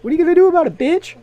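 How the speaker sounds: high, loud mocking taunt; taunting yell. Real, not acted. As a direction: taunt them loudly. Taunting friend in scuffle.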